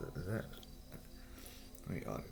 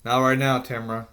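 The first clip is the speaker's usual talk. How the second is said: loud call across the house, pitched up; real shout; 6 semitones higher, 14 dB louder than usual. Real, not acted. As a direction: shout it off-room to Tamara. Calling to someone off-room.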